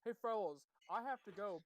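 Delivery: normal talking voice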